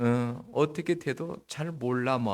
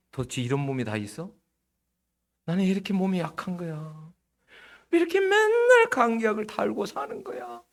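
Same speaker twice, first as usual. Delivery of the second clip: mock whiny complaint; 6 semitones higher than usual. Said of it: "complaining believer quote".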